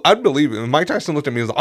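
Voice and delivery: ordinary talk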